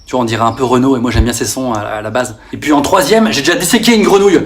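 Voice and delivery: ordinary delivery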